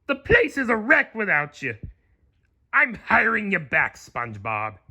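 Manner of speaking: high pleading crab voice; Mister Krabs. A narrator's reading. Mister Krabs begging SpongeBob to come back, pleading. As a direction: plead like the gruff old crab boss. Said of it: "cried Mister Krabs".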